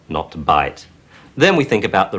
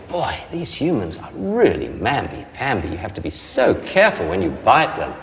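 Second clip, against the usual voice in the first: Jack's thoughts; 6 semitones higher than usual. Retold or imitated. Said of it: "Jack's gonna think".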